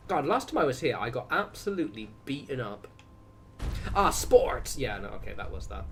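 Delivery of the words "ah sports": put-on American accent, high and loud; deliberate American accent. Streamer teasing after an accidental accent, deliberate American accent. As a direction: exclaim it in a mock American accent. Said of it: "That one was deliberate".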